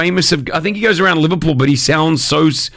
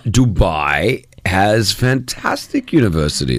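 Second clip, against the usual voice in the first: slow dad reply; 4 semitones lower, slower than usual. Retold or imitated. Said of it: "And then I said".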